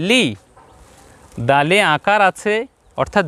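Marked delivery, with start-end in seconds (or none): 0.1-0.2 লি kept brief; a short, single-beat লি; short vowel. Shown as a demonstration. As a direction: say লি without stretching. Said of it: "লামে রসিকার আছে".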